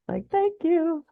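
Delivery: very high-pitched, drawn-out "thank you"; high, playful finally-you-get-it voice; exasperated thanks. Acted. Mock relieved thanks to doctors.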